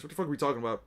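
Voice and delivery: ordinary delivery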